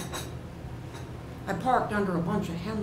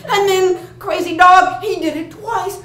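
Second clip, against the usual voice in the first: high, loud, incredulous telling; excited climax; 9 semitones higher, 10 dB louder than usual. A narrator's reading. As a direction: cry out the dog's madness. Dog attacks a second time.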